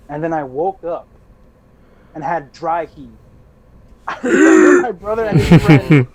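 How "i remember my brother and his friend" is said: loud, high laughing speech. Real, not acted. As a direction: laugh through the line. Laughing while recalling dry heaving.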